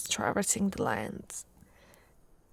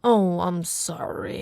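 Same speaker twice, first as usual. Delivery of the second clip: ordinary delivery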